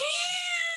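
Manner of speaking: squealing high laugh. Real, not acted. Laughing at hard role.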